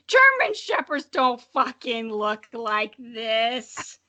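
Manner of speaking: slow, exasperated mock disbelief; mock outrage. Ridiculing the dog claim in her old story, slow mock outrage. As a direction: drag it out in fake indignation.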